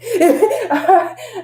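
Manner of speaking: bright amused laugh; short real laugh; high laugh. Real, not acted. Laughing at own past.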